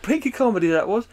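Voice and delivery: loud, high-pitched mock nostalgic gushing; hammed-up old bores shouting over their favorite TV memory; old dickheads reminiscing. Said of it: "We're a bunch of old dickheads".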